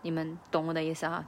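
ordinary talk

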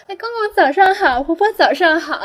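put-on sweet little girl calling hello to the old neighbours; polite little girl. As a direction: chirp it like a well-mannered kid greeting elders. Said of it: "被我妈妈教育的非常有礼貌的小女孩".

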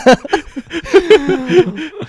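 long, very high-pitched laughter. Real, not acted. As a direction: laugh until breathless. Laughing at coach's order. (长时间高声大笑)

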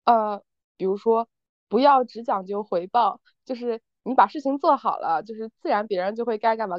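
usual speaking voice

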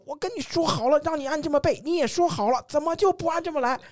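high-pitched, upset and on the verge of tears; imitating tearful complaint. Retelling how the teacher complained through tears, high and upset. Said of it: "然后就哇就哭了".

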